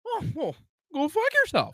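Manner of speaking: playful high-pitched fuck you; mock fuck you. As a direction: fling it at him in fun. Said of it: "That's my fuck you".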